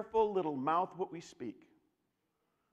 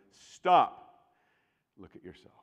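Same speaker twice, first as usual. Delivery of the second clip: firm stop; 4 semitones lower, 10 dB louder than usual. Real, not acted. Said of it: "self-admonition".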